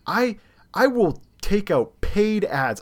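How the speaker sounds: high, slow, emphatic threat; retold rant. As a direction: spell out the threat word by word. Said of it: "I go through this rant".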